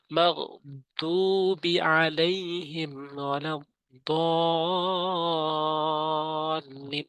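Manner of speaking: slow, drawn-out chanted recitation; Quran recitation. Shown as a demonstration. Teacher recites the verse for viewers, slow and chanted. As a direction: stretch each long vowel like tajweed. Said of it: "Gyne উচ্চারণ করতে হবে হলকের শেষ থেকে".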